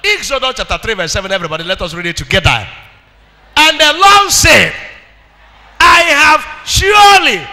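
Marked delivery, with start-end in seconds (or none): 3.6-4.7 loud, high preaching cry; rising shouted sermon; full-force preaching. Acted. 5.8-7.5 loud, high, slow preaching cry; shouted. Shown as a demonstration. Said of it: "Babrik, "I have surely".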